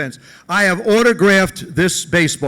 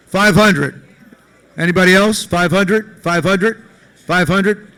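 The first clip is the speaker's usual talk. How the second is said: mock auctioneer; 6 dB louder, slower than usual. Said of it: "I'm not an auctioneer".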